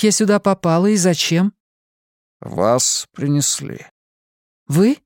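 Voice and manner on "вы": high, loud startled question; surprised. Acted. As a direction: snap the word out in shock. Simona startled.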